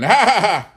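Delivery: high, loud mocking belly laugh; loud hearty guffaw. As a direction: cackle at your own joke. Alwyn laughs at his joke.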